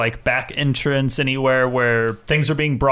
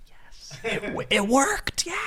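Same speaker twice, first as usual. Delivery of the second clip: whispered excitement; 10 semitones higher, 7 dB quieter, slower than usual. Acted. Willow's whispered thumbs-up.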